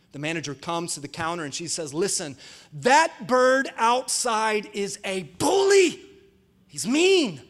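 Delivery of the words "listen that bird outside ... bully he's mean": slow, raised, emphatic outrage; aggrieved customer, hitting words hard; indignant complaint. Acted. Lady complains to the pet store manager.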